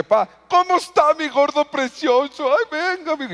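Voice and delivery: voz muy aguda y meliflua de papá zalamero; papá meloso. Retold or imitated. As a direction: imita al padre empalagoso, agudo y lento. Papá cariñoso fingido. (padre consentidor fingido, muy agudo)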